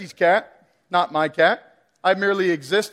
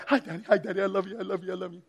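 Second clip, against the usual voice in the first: soft, doting puppy; a hint of an adoring puppy, softer; sweet dog voice; 8 dB quieter, much faster than usual. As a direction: coo it like a happy dog. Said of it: "he's like".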